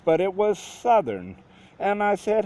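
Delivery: ordinary delivery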